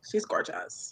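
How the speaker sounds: whispered swoon; hushed admiration. Real, not acted. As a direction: whisper it, quite smitten. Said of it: "I had to say it like that too".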